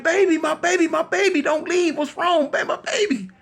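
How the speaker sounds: high-pitched pleading wail; anguished mother. Acted. Mother lifting dying Capone in her arms, high anguished pleading. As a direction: plead in a high, grief-stricken voice.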